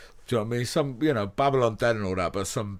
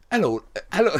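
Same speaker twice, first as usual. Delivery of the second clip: higher-pitched attempt at a Welsh greeting; bad Welsh accent; 6 semitones higher, 3 dB louder than usual. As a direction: have a clumsy go at a Welsh copper's accent. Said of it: "I can't do the accent".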